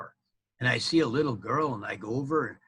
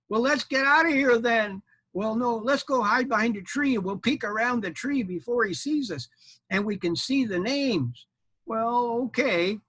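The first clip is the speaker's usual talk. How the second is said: high little armadillo voice; 9 semitones higher than usual. Acted. Armadillo urging knight to hide, high voice. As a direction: voice a small eager critter.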